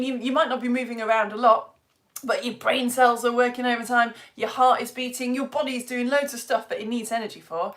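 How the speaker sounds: ordinary delivery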